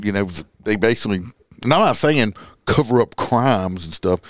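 usual speaking voice

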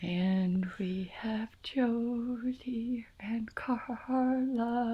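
whispered, small shaky singing; whisper-sing it small and trembling. Acted. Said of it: "My voice is shaky when I try to sound small".

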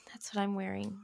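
breathy whispered aside. Acted. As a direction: whisper it under your breath.

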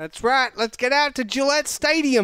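high-pitched hammed-up Boston accent; mock Boston sports fan; Boston accent impression. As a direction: play an excitable Boston local. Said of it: "you're a good impressionist".

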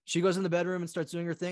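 usual speaking voice

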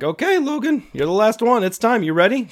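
rally her like a camp counselor; upbeat coaxing. Retold or imitated. Counselor cheerfully coaxing a stuck camper to go. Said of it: "And I'm like".

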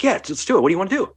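high-pitched, keen imitation of the tattooist; eager tattooist. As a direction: jump at the idea, pitched well up. Voicing eager tattooist's reply.

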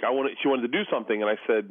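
usual speaking voice

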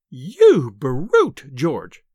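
loud, outraged wife; scolding scream, dropping on the name; screamed reproach. A narrator's reading. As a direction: cry out at your brute of a husband. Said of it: "she screamed".

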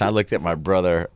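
ordinary talk, nothing marked

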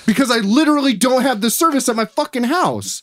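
high-pitched indignant outburst; outraged retort. Retold or imitated. Customer told to call back by cable company, outraged. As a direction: rant in exasperated disbelief, pitch way up.

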